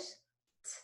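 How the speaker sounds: T. said as a sharp, breathy English T with a puff of air; English hard T. Shown as a demonstration. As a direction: give a crisp aspirated English "T". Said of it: "make the T sound in English".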